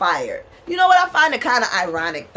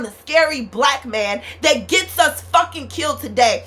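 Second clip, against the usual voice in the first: emotional outburst; 4 semitones higher than usual. Real, not acted. Venting to viewers about ancestors being wronged, emotional and heated. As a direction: let the frustration spill out, hitting the swear words hard. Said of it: "sorry if I'm getting emotional".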